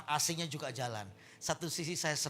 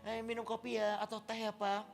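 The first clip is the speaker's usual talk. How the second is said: sweet welcoming wife; 6 semitones higher than usual. Acted. Wife greeting husband home from work, sweetly. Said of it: "ada ibunya".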